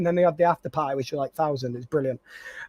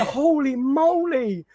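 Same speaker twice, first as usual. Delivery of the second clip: awed; 5 semitones higher, much slower than usual. Retold or imitated. Awestruck at driver's size.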